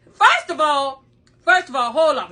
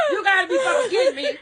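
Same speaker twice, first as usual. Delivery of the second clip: ordinary delivery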